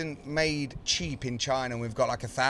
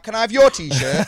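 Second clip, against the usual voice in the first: cheeky demand; 8 semitones higher, 9 dB louder than usual. Retold or imitated. Retold exchange with freebie seeker.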